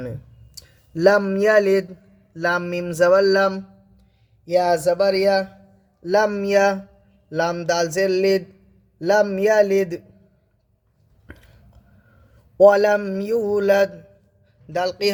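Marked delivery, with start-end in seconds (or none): 1.0-1.9 slow lifted recitation; measured recitation; Quran recitation. Shown as a demonstration. 9.0-13.9 slow lifted recitation; measured recitation; Quran recitation. Shown as a demonstration.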